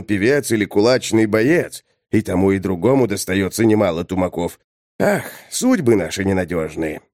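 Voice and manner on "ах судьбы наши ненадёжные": ordinary delivery, nothing marked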